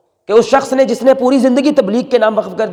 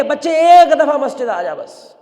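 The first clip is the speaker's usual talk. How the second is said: pleading; 6 semitones higher, slower than usual. Acted. Old man begging the drunk youth.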